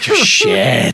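dismayed curse. Retold or imitated. Imagined dismay at fish gutting.

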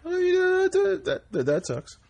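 high, squeaky mock sympathy. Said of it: "Go ahead".

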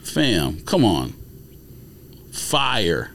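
ordinary talk, nothing marked

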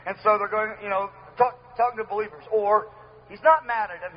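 usual speaking voice

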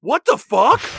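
slow, loud, stunned swear; mock horrified exclamation; shocked outburst. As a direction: drag it out in disbelief. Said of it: "David was like".

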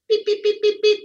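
high, loud, even electronic beeps; imitated phone beeps. Caller imitating the overseas phone tone, beeping. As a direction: mimic a ringing tone in short pulses.

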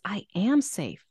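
"am" punched above the other words; stress on AM. Shown as a demonstration. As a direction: hit "am" in the phrase. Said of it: "the emphasis".

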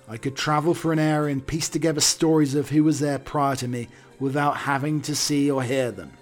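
ordinary talk, nothing marked

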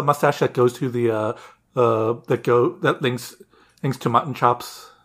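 ordinary talk, nothing marked

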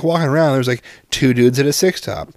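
ordinary talk

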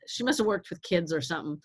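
ordinary talk

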